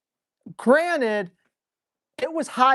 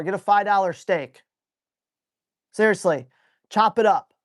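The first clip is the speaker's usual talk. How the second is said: usual speaking voice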